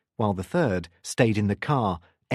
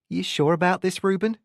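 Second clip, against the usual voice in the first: put-on higher voice with New Zealand accent; Kiwi accent; 8 semitones higher than usual. A narrator's reading. Mills doubting her partner, Kiwi accent. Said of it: "in her Kiwi accent".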